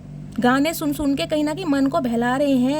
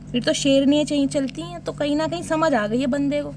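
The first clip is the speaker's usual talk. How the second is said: normal talking voice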